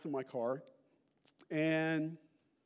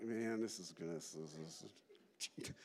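hesitant mumble; 12 semitones lower, 12 dB quieter, slower than usual. Retold or imitated. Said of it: "and like".

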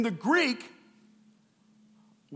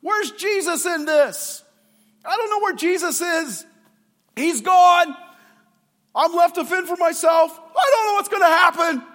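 high, loud, desperate shouting; panicked disciple; 8 semitones higher, 8 dB louder than usual. Acted. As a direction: yell it over the storm in fear. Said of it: "we go".

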